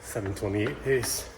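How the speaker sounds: ordinary talk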